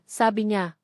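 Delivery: ordinary delivery, nothing marked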